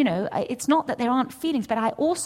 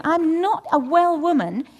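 ordinary delivery

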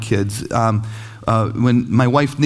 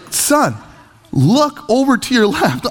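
high, slow, pointed parent voice; mock-patient dad, pitched up; exasperated parent; 8 semitones higher, slower than usual. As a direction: spell it out for a clueless child. Exasperated parent answers screaming kid.

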